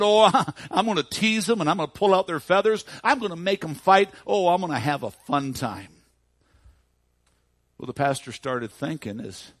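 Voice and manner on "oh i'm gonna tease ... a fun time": loud, high and gleeful, chuckling at the start; mischievous boy. Retold or imitated. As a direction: crow with naughty relish like a lad. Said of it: "And the boy said".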